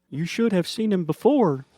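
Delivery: high, loud grinning taunt; gleeful taunt. Acted. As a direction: grin as you say it. Billy grins, taunts.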